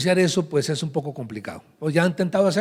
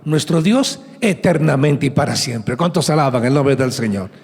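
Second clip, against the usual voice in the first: louder, emphatic scripture proclamation; rousing preaching; 4 dB louder than usual. Acted. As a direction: drive the verse home to the congregation. Reads verse, rallies congregation.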